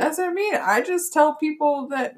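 ordinary talk

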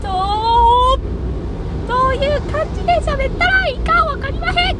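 overshoot into a squeaky high voice; high falsetto voice. Shown as a demonstration. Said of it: "あ、違うわ". (裏声の高い声)

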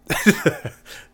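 short high laugh; quick incredulous chuckle. Real, not acted. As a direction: burst out in a brief, high-pitched laugh. Laughs at how messed up it was.

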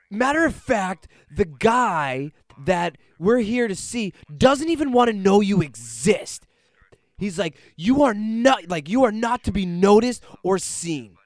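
high, loud stern telling-off; scolding. Retold or imitated. Friend scolds him loudly for oversharing. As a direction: lay into him hard.

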